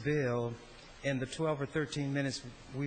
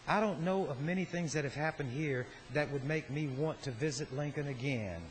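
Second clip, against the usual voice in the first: usual speaking voice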